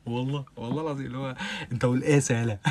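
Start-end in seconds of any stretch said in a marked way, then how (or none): none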